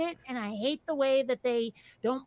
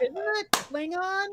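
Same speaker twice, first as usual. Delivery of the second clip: puzzled aside; 7 semitones higher, slower than usual. Retold or imitated. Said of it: "And I was like".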